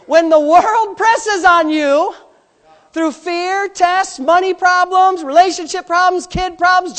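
rising, loud preacher's build; preaching at full force. Acted. Pastor pressing the congregation, preaching full force. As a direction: hammer each problem home to the church.

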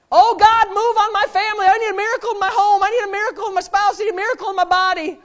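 high, loud, fast pleading prayer; mock desperate prayer. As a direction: ham up a frantic plea to God. Mimics desperate prayer for miracles.

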